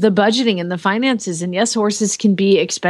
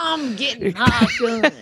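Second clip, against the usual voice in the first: mock southern father's threat; extreme southern accent. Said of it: "his extreme southern accent saying that".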